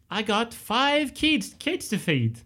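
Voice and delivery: high, slow put-on voice; Benny from Total Recall. Host acting Benny's line for the film quiz, high and slow. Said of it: "Benny from Total Recall".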